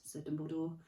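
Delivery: ordinary delivery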